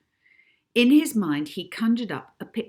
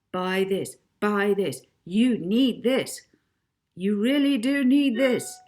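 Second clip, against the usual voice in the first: loud, hard-sell advert pitch, louder and higher as it goes; building hard-sell ad voice; pushy TV ad voice; 4 semitones higher, 7 dB louder than usual. Acted. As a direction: sell it like a relentless commercial. TV ad voiced in story.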